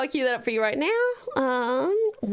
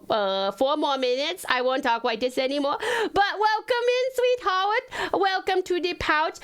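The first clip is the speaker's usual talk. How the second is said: put-on baby talk; 2 semitones higher than usual. Streamer welcomes new follower Stella, cutesy baby talk. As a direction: coo a welcome in toddler speech, r and l as w. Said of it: "I promise I don't normally talk like this".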